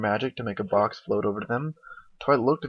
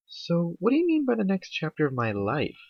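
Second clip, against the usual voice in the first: raised-pitch, puzzled question; Twilight's questioning voice; 8 semitones higher than usual. Acted. Twilight asking Rarity what she means, high questioning voice. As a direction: ask it high and curious.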